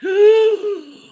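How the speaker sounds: loud high-pitched gasp; high, exaggerated gasp of shock; mock shocked gasp. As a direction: re-enact a big horrified intake of breath. Acts out shocked reaction in story.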